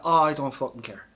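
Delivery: ordinary talk, nothing marked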